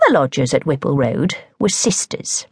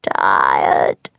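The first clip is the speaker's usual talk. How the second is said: deep, slow croaking toad voice; one heavy grumpy word from a toad; sulky toad. A narrator's reading. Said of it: "said a single word".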